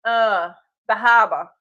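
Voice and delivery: harbor said hah-buh with no r, in a light Boston accent; a playful Boston accent on harbor. Shown as a demonstration. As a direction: drop the r in harbor, Boston style. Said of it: "That's my Bostonian accent for the day".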